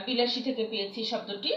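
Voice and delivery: normal talking voice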